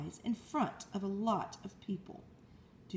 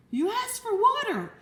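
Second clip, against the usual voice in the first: ordinary delivery, nothing marked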